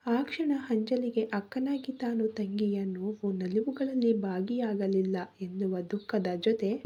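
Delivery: plain reading voice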